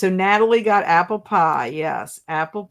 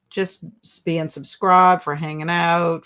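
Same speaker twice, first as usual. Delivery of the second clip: ordinary talk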